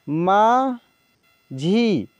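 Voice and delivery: माझी drawn out very slowly, sound by sound; slow dictation of माझी; slow spelled-out reading. Shown as a demonstration. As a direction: stretch माझी for the class. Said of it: "teacher reads word slowly".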